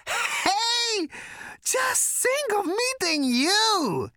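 extremely high, slow, giddy squeal; gasping excitement. A narrator's reading. Prack marvels at meeting Arthur, gasping and squealing. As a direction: overcome with hilarity, stretch it out squealing. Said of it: "he gasped".

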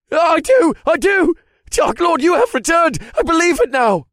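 shrill, desperate high voice; terrified pleading. Acted. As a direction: plead for your life.

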